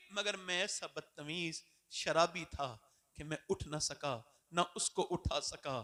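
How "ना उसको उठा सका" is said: ordinary delivery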